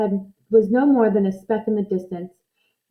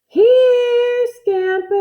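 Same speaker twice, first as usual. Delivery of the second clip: much higher, louder drawn-out call; long loud call; 12 semitones higher, 5 dB louder, much slower than usual. Acted. Boy's last long loud call over the hill. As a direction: hold it long so it echoes. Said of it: "Such a long, loud".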